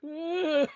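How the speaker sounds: a high startled "uh"; startled grunt. Retold or imitated. Man picking up the wine bottle, a startled grunt. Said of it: "he's like".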